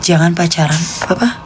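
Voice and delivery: ordinary delivery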